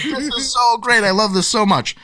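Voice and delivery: high, gushing delight. Retold or imitated. Author reacting to kids' story time, high gushing delight. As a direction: gush like it's the best moment. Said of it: "And I'm like".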